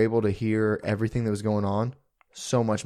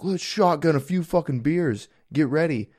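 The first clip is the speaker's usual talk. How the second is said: 1 frat guy; 6 semitones higher than usual. Retold or imitated. Frat party guys voiced.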